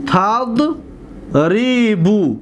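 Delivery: TAD, long pause, then drawn-out RI-BU in تضربو; very slowly split تضربو into its syllables. Shown as a demonstration. Teacher breaks word into syllables. (تضربو را بسیار آهسته و هجا به هجا)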